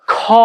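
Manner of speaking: "খ" with a strong puff of air; heavily aspirated "খ"; aspirated kho. Shown as a demonstration. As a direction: push breath out hard on "খ". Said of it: "এখানে চাপ পড়ছে".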